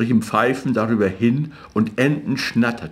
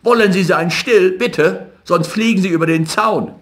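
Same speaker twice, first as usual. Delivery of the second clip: much higher, louder, rushing at the end; angry shout; 8 semitones higher, 4 dB louder than usual. A narrator's reading. Rothschild furious, shouting back at Jakob. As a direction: yell back in sudden fury. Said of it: "geriet in Zorn und schrie ebenfalls".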